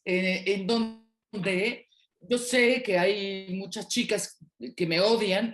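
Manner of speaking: ordinary talk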